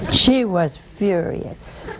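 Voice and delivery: chuckling, then a slow she was furious; amused at the widow's anger, laughing it out. Real, not acted. Laughs while retelling.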